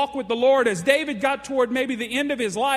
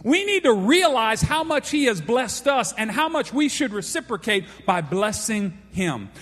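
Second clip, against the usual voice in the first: usual speaking voice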